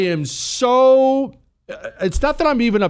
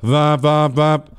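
muffled mask mumble; 3 dB louder, slower than usual. Retold or imitated. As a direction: mumble like talking through three masks. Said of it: "She pulls down her mask".

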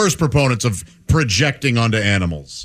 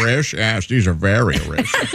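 low, lisping pet voice; lisping cat voice; 8 semitones lower than usual. Retold or imitated. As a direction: voice the cat with a lisp. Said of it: "They have a speech impediment".

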